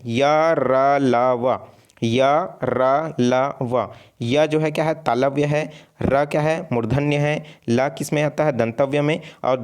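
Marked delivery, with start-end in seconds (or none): 0.0-3.9 य, र, ल, व each drawn out and set apart; letters sounded out. Shown as a demonstration. Teacher giving students the four letters to memorise, sounded out. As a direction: recite य, र, ल, व one long syllable at a time. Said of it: "कौन कौन से हैं उसको भी आपको याद रखना है".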